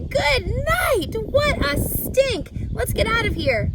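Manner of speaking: loud, high disgusted yelling; boy yelling to flee the smell; screaming. A narrator's reading. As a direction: shout it, gagging on the stink. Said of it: "screamed Avery".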